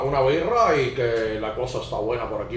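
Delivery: mock Spaniard holding a beer; Spain Spanish accent. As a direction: lisp it like a guy from Spain. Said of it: "how the people from Spain talk".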